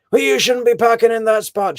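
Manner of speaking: high, loud telling-off; retold parking lot argument, loud and scolding; scolding shout. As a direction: yell the rule at them. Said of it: "she says".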